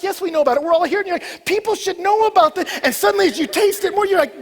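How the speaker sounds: loud, high-pitched pulpit preaching; full-force preaching. Acted. Preacher urging church to go taste, loud preaching. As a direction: drive it home to the whole congregation. Preaches snow cone story loudly.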